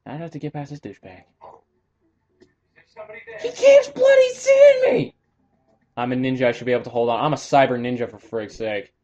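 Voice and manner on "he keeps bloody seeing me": high, slow, frustrated wail; exasperated yell. Real, not acted. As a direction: wail it out at the screen. Gamer yelling at enemy.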